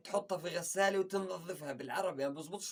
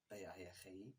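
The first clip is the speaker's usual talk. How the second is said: muttered echo; 8 semitones lower, 14 dB quieter than usual. Retold or imitated.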